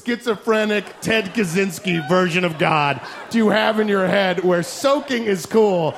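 usual speaking voice

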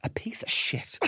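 hushed whisper, half laughing; whispered punchline. Retold or imitated. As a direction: drop to a whisper for the payoff. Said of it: "George said".

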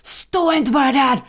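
high, loud mock scolding; hammed-up exasperated shout; imitating husband's stressed yell. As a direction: play the panicked husband barking orders. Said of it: "vänder sig Zev mot mig och bara".

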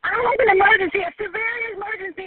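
frantic loud cry for help; panicked shout. Real, not acted. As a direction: yell it out in real panic. Panicked 911 caller.

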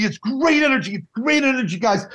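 high, frantic director pleading; Dan. Retold or imitated. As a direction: play the panicking director. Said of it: "I do a very good Dan".

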